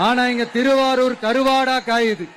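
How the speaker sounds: loud campaign oratory over a crowd; rally speech. Real, not acted. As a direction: rouse the Tiruvarur crowd, mocking the CM. Loud rally oration in Tiruvarur. (உரத்த பிரச்சார மேடைப் பேச்சு)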